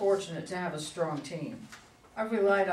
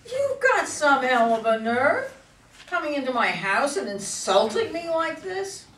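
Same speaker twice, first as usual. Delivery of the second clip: furious outburst; 7 semitones higher, 3 dB louder than usual. Acted. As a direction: let the insult boil over, climbing higher each line. Insulted governor confronts rival.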